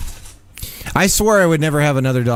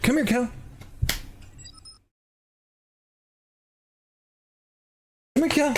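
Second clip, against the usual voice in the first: higher-pitched call to a pet; lightly sung-up call to Calvin; calling the dog; 11 semitones higher, 7 dB quieter than usual. Real, not acted. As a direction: coax the dog over.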